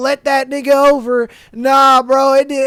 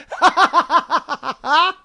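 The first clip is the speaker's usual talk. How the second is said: long rapid staccato cackle; helpless run of high giggles; laughing; 3 semitones higher than usual. Real, not acted. Reacting to the drunk booty call story, laughing hard.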